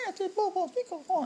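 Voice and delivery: very high, soft babbling; father mimicking his crawling son's babble; baby babble. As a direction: babble like an eight-month-old. Imitating his baby's babble.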